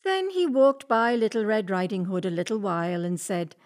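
ordinary delivery, nothing marked

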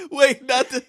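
high, loud laughing. Real, not acted. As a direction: talk while cracking up. Laughing through the story.

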